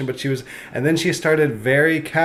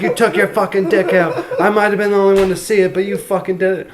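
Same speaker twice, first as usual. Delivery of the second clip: mock outrage; 6 semitones higher than usual. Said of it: "She's like".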